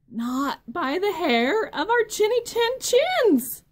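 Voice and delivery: high, loud squeaky pig voice; little pig voice. Acted. Little pig defiantly refusing the wolf, high and loud.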